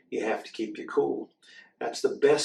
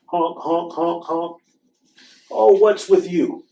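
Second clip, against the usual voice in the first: higher honking goose, louder at the end; goose honks; 6 semitones higher, 8 dB louder, slower than usual. Acted. Geese smoothing things over, higher honking. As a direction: honk like a fussy goose. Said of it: "The geese stepped in".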